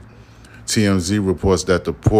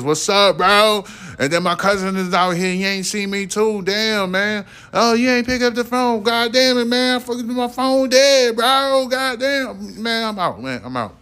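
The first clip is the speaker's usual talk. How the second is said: much higher, rising into a squeaky excited rant; mock frantic rant; 13 semitones higher than usual. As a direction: ham up a flustered guy making excuses to leave. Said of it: "hit her back with some words like".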